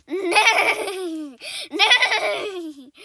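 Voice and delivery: laughing "nay, nay" like a horse; playful horse neigh. Pretend. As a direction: neigh it out with a giggle. Voicing the horse.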